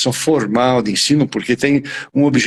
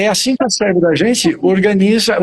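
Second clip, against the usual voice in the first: ordinary talk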